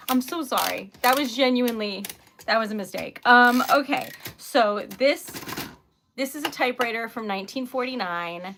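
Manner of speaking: ordinary talk, nothing marked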